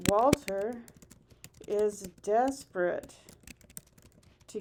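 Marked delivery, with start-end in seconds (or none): none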